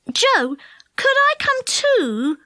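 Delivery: put-on high pleading child; little brother begging to ride along. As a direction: plead up to Joe. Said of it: "he begged".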